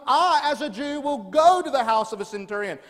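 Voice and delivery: "I" punched high and loud; emphatic I. Shown as a demonstration. Pastor voicing Jesus' bold promise to the crowd, "I" stressed. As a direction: declare it, leaning on "I". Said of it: "this emphatic I".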